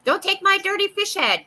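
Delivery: high, loud squawky crow; be a greedy crow guarding its food. Acted. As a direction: crow defending its fish head. Voicing the crow.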